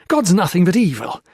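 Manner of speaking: loud, high furious tirade; raving at the priest about bloodshed. A narrator's reading. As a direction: hurl every grievance in rage. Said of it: "Peter Marlowe raved".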